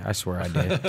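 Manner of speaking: laughing through the words. Real, not acted. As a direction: say it while cracking up.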